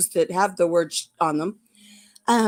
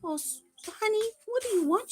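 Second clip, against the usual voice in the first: talking to the puppy; 12 semitones higher, 6 dB quieter than usual. Real, not acted. Owner cooing at her puppy that jumped up, soft. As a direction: coo to the pet.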